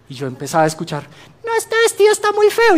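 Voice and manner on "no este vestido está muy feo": much higher, put-on complaining voice; high mock whine about the dress; whiny family member. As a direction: mimic a fussy relative griping about the dress.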